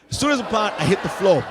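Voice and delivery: ordinary talk, nothing marked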